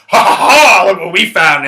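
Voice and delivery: loud mock laugh, then gloating. Cops gloating over his joints, mocking. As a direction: imitate cops crowing over their find.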